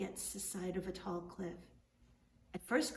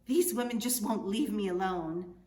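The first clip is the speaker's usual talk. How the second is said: boastful caballero; 6 dB louder than usual. Acted. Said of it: "he would continue with".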